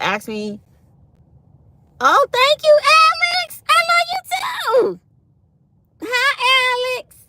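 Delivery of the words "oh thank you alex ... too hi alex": high sweet mock voice of the dentist, loud; sing-song imitation of a gushing dentist; mimicking dentist. Dentist gushing thanks to the patient, high sweet mock voice.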